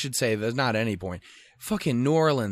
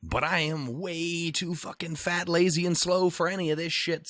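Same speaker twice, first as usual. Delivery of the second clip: higher, slow exasperated whine; mock weary protest; 6 semitones higher than usual. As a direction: whine that you're too tired. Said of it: "And I'm like".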